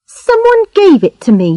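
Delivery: put-on high, sulky girl voice; sulky young girl. A narrator's reading. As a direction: brush it off like a pouting girl. Charlotte voiced.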